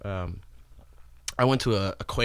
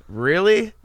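incredulous; 10 semitones higher, 9 dB louder than usual. Retold or imitated. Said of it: "people would always be like".

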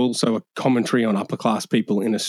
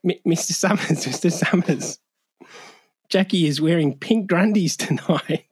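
high-pitched puppet-style comic voice; Dickie Knee; 7 semitones higher than usual. Acted. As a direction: put on a high, silly character voice. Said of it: "hear your Dickie Knee impersonation".

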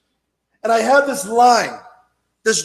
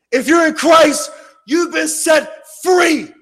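loud, high fiery preaching; preaching at full force; 5 semitones higher, 4 dB louder than usual. Acted. Preacher warning the congregation about Satan, loud fiery preaching. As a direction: thunder it from the pulpit.

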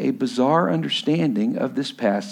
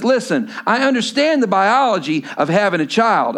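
raised, driving sermon voice; preaching; 7 semitones higher, 4 dB louder than usual. Acted. Pastor contrasting Zechariah and Mary for his church, preaching. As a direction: press the contrast home to the room.

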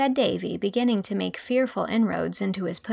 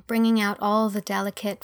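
usual speaking voice

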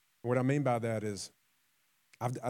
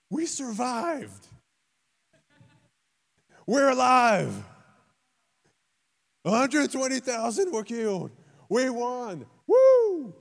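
much higher and louder, hammed-up celebrating; mock victory cheer; 12 semitones higher, 9 dB louder, slower than usual. Preacher mocking people who celebrate survival, hammed-up high cheering. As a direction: whoop like survivors who just won.

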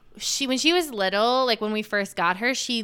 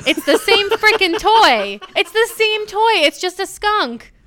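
much higher and louder, fed up; exasperated; 10 semitones higher, 7 dB louder than usual. Real, not acted. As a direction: blurt it out in real disbelief. Exasperated about the noisy toy.

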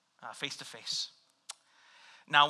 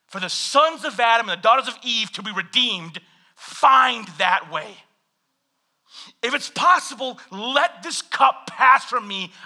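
pleading prayer; 6 semitones higher, 7 dB louder than usual. Acted. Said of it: "So Jesus is like".

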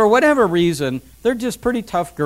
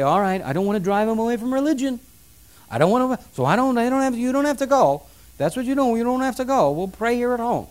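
higher-pitched, placating parent voice; mock caving in; 5 semitones higher than usual. Parent afraid of driving teenager from religion, mock meek giving in. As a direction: give in meekly and hurriedly.

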